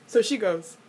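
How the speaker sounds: usual speaking voice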